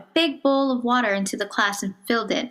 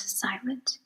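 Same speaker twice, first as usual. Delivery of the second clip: breathy whisper of the word silent; a hushed whisper; 2 semitones higher, 9 dB quieter than usual. A narrator's reading. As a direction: let it almost vanish. Narration, single word.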